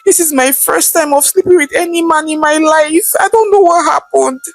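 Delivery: high, pleading sob in the voice; begging and crying. A narrator's reading. Accused girl begging the police, crying. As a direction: beg for your life through tears. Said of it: "begging and crying".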